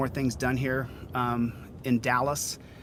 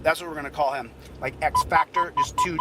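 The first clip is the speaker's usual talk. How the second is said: ordinary talk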